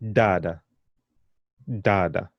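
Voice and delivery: dada with emphatic d sounds; dada, firm hard d. Shown as a demonstration. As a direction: press a little on each D in dada. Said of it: "they have a bit of emphasis on them".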